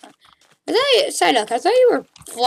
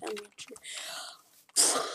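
whispered aside; 13 dB quieter, much slower than usual. Real, not acted. Muttering to himself.

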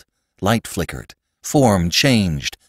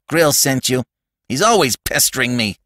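higher-pitched indignant complaint; annoyed accusation; 6 semitones higher than usual. Acted. Robbie sure that Grill sent the stranger, indignant. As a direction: complain crossly at him.

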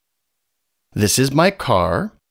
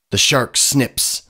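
plain reading voice

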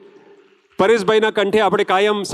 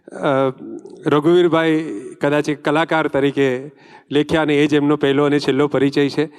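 ordinary talk, nothing marked

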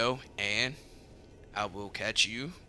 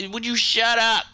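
annoyed shout; 5 semitones higher, 10 dB louder than usual. Real, not acted. Snapping at someone nearby.

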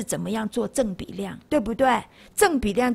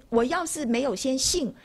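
ordinary talk

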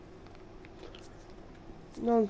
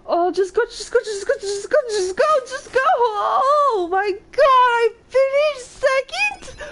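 high, loud urging that bursts into a drawn-out shriek; real race-finish thrill, shouting in disbelief; frantic excited yelling; 8 dB louder, slower than usual. Real, not acted. Player urging on the last seconds of a race, frantic excited yelling.

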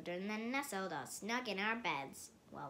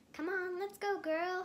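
high, loud call to go; friends shouting; 11 semitones higher, 5 dB louder than usual. A narrator's reading. Running pals calling Mom out the door, high and loud. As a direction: shout it across the yard. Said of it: "her friends shouted real loud".

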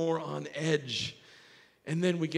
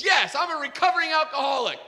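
heated retort; 10 semitones higher, 4 dB louder, faster than usual. Retold or imitated. Acted yelled answer in quarrel.

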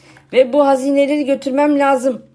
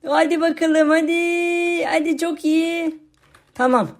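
ordinary talk

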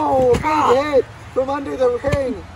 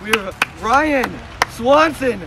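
slow build to a shouted name; ring announcer; 6 dB louder, much slower than usual. Acted. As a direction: announce the fighter, end loud.

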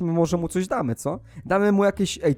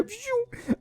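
high zooming whoosh; quick swoosh of something speeding off; whoosh sound; 8 dB quieter than usual. Pretend. Sonic runs to heaven.

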